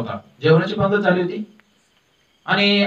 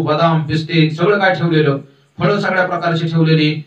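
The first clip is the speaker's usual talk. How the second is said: ordinary talk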